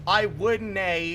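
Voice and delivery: slow, drawn-out "I would nay" in a put-on accent; a hint of a put-on accent; slow fake accent. Shown as a demonstration. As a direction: read the odd spelling out slowly. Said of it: "if I just say".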